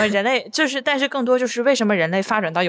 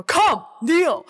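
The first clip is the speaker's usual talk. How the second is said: mock imperious order, pitched higher; louder command; 8 semitones higher than usual. Reverend Mother ordering Paul to kneel, louder imitated command. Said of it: "Reverend Mother更大声说".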